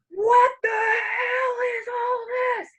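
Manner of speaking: high, drawn-out outraged shout; screaming angry date. Retold or imitated. Date exploding over a parking ticket, screaming. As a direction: stretch it into a high enraged yell. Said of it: "he starts screaming at me".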